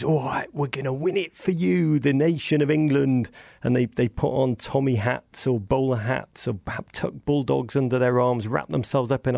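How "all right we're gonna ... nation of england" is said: higher-pitched rallying promise, hammed-up; imitating a confident tournament send-off; mock team boast. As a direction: proclaim it like a team pledging glory to the nation. Acting out England's pre-tournament boast.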